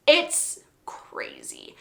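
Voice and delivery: breathy secret whisper; confiding to fans who have read it, whispered. Real, not acted. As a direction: lean in and whisper it. Whispered conspiratorial aside.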